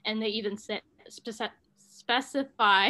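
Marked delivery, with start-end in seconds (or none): none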